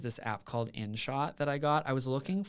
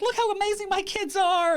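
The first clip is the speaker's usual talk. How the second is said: very high, loud showy shout; hammed-up high-pitched boast at full volume; in-your-face bragging; 11 dB louder than usual. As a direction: yell it out as a shameless brag. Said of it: "in your face".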